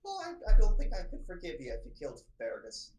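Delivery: loud, high, indignant librarian outburst; a protective scholar defending her lad; indignant Diana. Acted. GM voices Diana.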